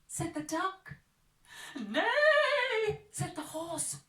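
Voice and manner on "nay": very high loud neigh; whinny it out. Acted. Animal sound.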